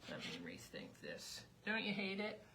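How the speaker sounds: ordinary delivery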